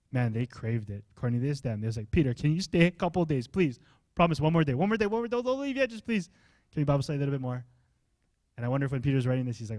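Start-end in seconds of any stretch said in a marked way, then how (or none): 2.1-7.6 rushed, high-pitched begging; playful pleading. Acted. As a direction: beg eagerly, tumbling over the words. Acting out hearers begging Peter to stay.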